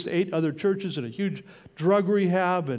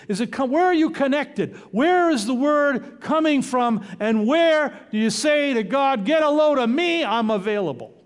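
rising, louder preacher's call; preaching build; 6 semitones higher, 3 dB louder than usual. Acted. Sermon climax.

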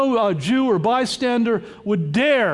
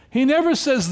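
ordinary delivery

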